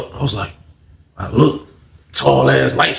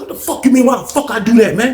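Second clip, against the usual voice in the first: high, retold indignant retort; angry comeback; 8 semitones higher than usual. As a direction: throw the question back, imitating anger.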